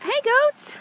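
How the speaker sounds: high-pitched hello to livestock; calling to animals. Real, not acted. Keeper passing the goats by the fence, calling to animals.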